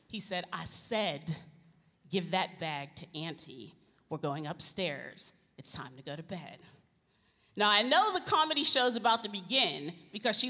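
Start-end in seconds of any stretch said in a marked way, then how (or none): 0.5-6.6 stern dad. Retold or imitated. Brother repeats command, firmer.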